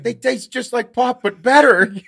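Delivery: high, loud imitation of wired dad; slow, emphatic hammed-up rave; jacked up. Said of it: "like, a little jacked up".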